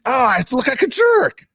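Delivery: high, drawn-out comic wail of embarrassment; mock exasperation. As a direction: act out kicking yourself. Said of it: "most frustrating and embarrassing thing".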